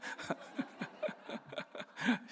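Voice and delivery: amused, sustained chuckling; real chuckle. Real, not acted. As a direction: laugh at his own anecdote. Laughs at wife's retort in anecdote. (재미있어서 터진 웃음)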